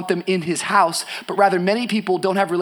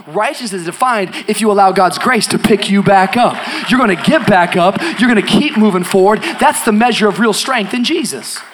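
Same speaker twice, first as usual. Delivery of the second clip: loud, punchy preaching at the speaker's usual pitch; emphatic preaching; 7 dB louder than usual. Real, not acted. Preacher urging the congregation to get back up, emphatic. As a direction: drive each promise home forcefully.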